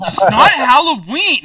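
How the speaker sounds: loud, high-pitched flustered outburst; acted exasperated shout; flustered protest. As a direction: protest as a nerd losing his composure. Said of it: "He's getting, like, visibly flustered".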